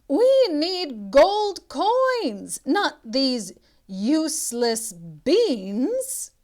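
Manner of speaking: furious mother, shouting high and loud, slow; angry shout. Acted. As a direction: yell it at your son in a rage, drawn out. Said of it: "she shouted".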